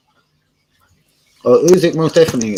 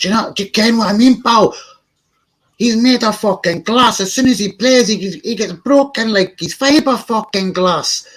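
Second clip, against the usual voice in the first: Braveheart Scottish; 12 semitones higher, 3 dB louder than usual. Retold or imitated. Ranting about Kieran Tierney to chat, hammed-up Scottish accent. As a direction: rant like a broad Scotsman in a Scottish accent. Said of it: "giving it my Braveheart's finest".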